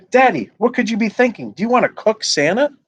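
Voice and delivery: put-on high, outraged little girl voice; a shocked child scolding her dad; indignant child. Olivia objecting to her father building a fire.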